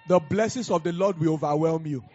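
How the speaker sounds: loud prophetic declaration over music; thundering prophetic blessing; preaching at full force. Acted. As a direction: proclaim it with rising force.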